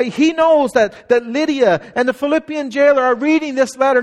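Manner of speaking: ordinary talk, nothing marked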